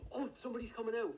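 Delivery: high startled gasp; surprised. Real, not acted. Suspect suddenly appears.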